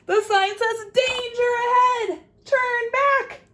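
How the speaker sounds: high, loud urgent cry; urgent warning shout. Acted. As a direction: warn her off loudly. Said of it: "squirrel says".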